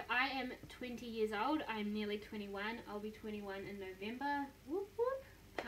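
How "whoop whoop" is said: high squealing whoop whoop; excited whoop. Real, not acted. Young woman cheering her coming birthday, high excited whoop. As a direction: cheer it like a party. Celebrating turning twenty-one.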